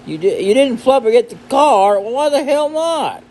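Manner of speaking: high put-on incredulous voice; squeaky mock indignation; indignant questioner.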